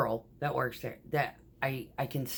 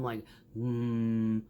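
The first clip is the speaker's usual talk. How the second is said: hum imitation; 6 semitones lower than usual. Imitating the truck's low hum.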